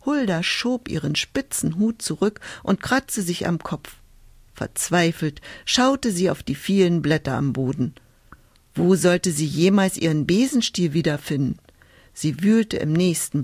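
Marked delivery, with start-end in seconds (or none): none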